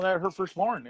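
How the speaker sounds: usual speaking voice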